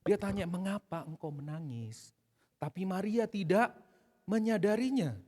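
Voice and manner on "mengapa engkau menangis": softer, slow tender question; gentle Jesus. Acted. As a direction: ask softly like comforting a mourner. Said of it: "Tuhan Yesus mendatangi dengan lembut". (Yesus bertanya dengan lembut dan pelan)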